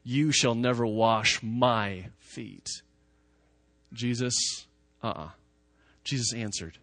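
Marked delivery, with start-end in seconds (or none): 0.0-2.7 very slow, each word pressed, a firm stress on "never"; emphatic refusal. A narrator's reading. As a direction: draw it out word by word, refusing outright. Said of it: "the Greek is really emphatic".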